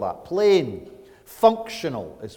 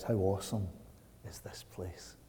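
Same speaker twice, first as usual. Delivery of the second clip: low, hushed, fading into a whisper; hushed awe; 3 semitones lower, 12 dB quieter than usual. Retold or imitated. Preacher quoting Jacob to the congregation, hushed awe. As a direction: let it sink away in quiet awe.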